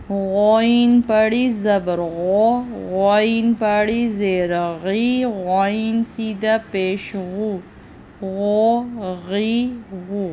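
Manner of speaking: غو غی غو said full and thick from the throat; heavy voice on غ; heavy ghain. Shown as a demonstration. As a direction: make غین heavy and full. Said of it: "موٹی آواز کے ساتھ پڑھا جاتا ہے".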